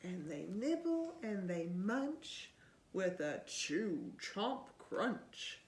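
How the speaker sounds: put-on lively read-aloud to a child; storybook reading. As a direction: read it like bedtime story time. Reading picture book aloud.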